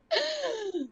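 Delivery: sharp tearful gasp; mother overwhelmed at the plane, gasping; crying gasp. Retold or imitated. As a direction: catch the breath in a sob. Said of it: "I was like".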